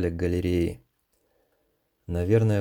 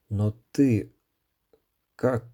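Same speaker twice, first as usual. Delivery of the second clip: stunned mumble; 3 semitones higher, much slower than usual. A narrator's reading. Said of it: "ошалело пробормотал парень".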